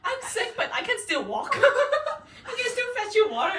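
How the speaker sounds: high, loud laughing through the words; laughing quote. Retold or imitated. Reenacting fetching water for sick friend, laughing high. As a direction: giggle while acting brave. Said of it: "I was like".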